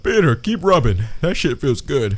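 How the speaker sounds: a cat begging to be petted. Retold or imitated. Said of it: "And he's like".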